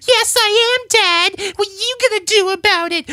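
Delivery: high puppet voice. Acted. Hand puppet greeting Dan in a squeaky falsetto.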